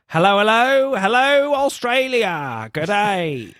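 hammed-up [name] in costume. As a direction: camp it up to the crowd. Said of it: "in his Queen Elizabeth costume and says".

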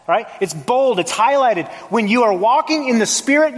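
ordinary talk

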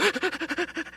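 high, rapid mock gasps; smelling-salts gasping. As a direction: gasp as if jolted by smelling salts. Acts out reaction to smelling salts.

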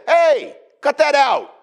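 a hammed-up scolding shout; disciples scolding. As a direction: bark it like a bossy disciple. Disciples scolding a rival preacher.

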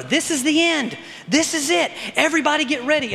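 high-pitched mock doomsday cry; mock alarm. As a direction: sound the end-times alarm, pitched high. Voices recurring end-times alarm.

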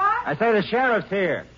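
slow, carrying call to the wife. Acted. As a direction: call it out so she hears inside. Husband calls to wife inside.

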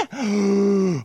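high, sharp intake of breath; shocked gasp. Pretend. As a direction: gasp as if scandalised. Mock shock.

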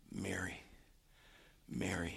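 hushed, low, near whisper; very quiet, low and intimate; tender name-calling. Acted. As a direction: softly call someone grieving by name. Jesus gently says Mary's name.

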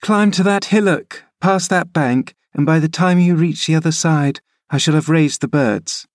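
put-on a girl urging her nurse uphill; eager young Cathy. Cathy orders nurse onward.

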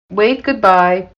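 ordinary delivery

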